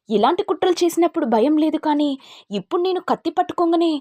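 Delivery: enraged high-pitched repeated taunt; furious taunt. Acted. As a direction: press the threat home.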